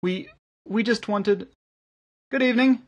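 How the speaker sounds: high, hesitant youth, then a greeting; shy young man's high voice; stumble, then greet politely in a high voice; young worker. A narrator's reading. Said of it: "the young worker greeted him".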